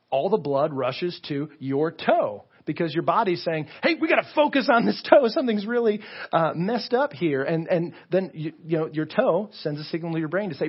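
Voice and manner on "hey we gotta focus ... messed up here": high, loud, urgent mock alert; alarmed body. Body alerting you to a stubbed toe, mock alarm. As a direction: raise the alarm, easing off toward the end.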